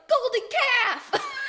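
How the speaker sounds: high, loud, drawn-out mock shout; hammed-up high holler; mock cheering cry. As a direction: call it out like a gleeful crowd. Mocking the Israelites' cry in retelling.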